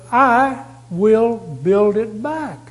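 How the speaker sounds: slow, firm and emphatic, each word weighed; firm declaration. Retold or imitated. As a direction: declare it with total certainty. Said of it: "He said".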